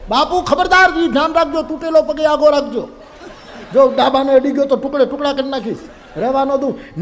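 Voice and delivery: loud, high threatening rant with laughter; hammed-up furious disciple, threatening, breaking into laughs; angry disciple. Disciple threatens over his leg. (ગુસ્સે ભરાયેલો ચેલો, ઊંચા મોટા અવાજે ધમકી)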